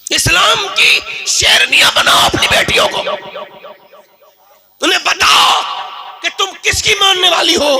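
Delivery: high, loud impassioned exhortation with a sob; preacher urges fathers to raise lionesses; fiery preaching. Real, not acted. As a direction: rouse the crowd to tears. Preaching at full force.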